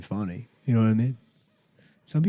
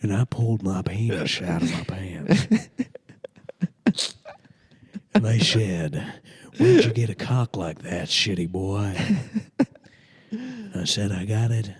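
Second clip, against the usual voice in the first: Texan comic spinning a yarn; Texas accent. Acted. Comic making up a stand-up story, Texas accent. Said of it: "maybe I'll just do a Texas accent".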